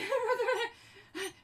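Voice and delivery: a high, loud laugh; burst of laughter. Real, not acted. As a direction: laugh at yourself. Laughing at herself.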